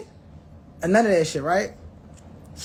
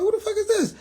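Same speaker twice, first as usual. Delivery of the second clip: shocked high squeak; 13 semitones higher than usual. Retold or imitated. Narrator recalls seeing a stranger crash, high disbelieving squeal. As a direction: squeal it like you can't believe who crashed. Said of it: "I'm like".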